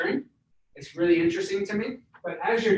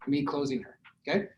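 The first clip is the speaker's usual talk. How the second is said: ordinary delivery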